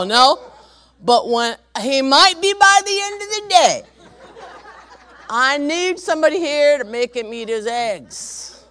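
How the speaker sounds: very high, loud exasperated wail; hammed-up shout of a grandma at her limit; mock hysterical demand. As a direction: wail it loudly, drawing out the words. Acted frantic call to dispatcher.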